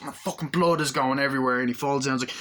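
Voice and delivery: ordinary talk, nothing marked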